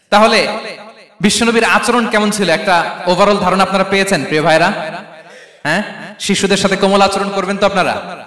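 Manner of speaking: normal talking voice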